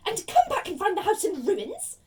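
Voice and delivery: high, loud scornful snarl. Acted. Aunt refusing to leave her nephew alone, snarling. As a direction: throw the idea back with angry scorn. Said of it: "she snarled".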